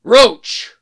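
loud call across the stable; shout. Acted. Geralt shouts Roach's name across the stable, loud. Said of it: "He shouted across the stable".

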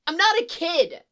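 loud, high indignant outburst; indignant protest. Acted. Boy objects to being called kid, indignant. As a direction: yell it like an offended child.

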